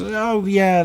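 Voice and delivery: high-pitched offhand "oh yeah"; retold casual recognition. Reviewer quoting people half-remembering a game title, offhand recognition. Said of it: "it was one of those games that was".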